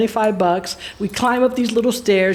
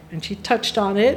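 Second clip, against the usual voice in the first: ordinary talk, nothing marked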